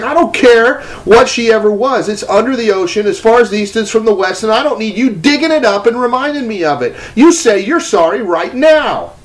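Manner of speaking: high, insistent scolding in God's role; screaming rebuke. Retold or imitated. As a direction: lay down the law, slowing into the final order. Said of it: "is screaming at me and says".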